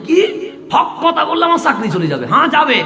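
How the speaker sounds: high, loud, ringing sermon cry; full-force waz preaching shout; fiery preaching. Acted. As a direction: preach it at the top of your voice, stretching the quote.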